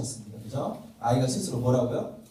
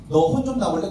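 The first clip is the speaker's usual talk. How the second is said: louder mock threat to a child; threatening mom; 4 semitones higher, 7 dB louder than usual. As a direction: scold like a mother threatening punishment. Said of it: "이건 협박에 가까운 거죠".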